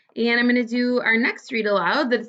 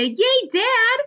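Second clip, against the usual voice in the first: cheering; 3 dB louder than usual. A narrator's reading. Girl cheers her dad.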